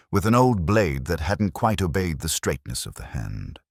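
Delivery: plain reading voice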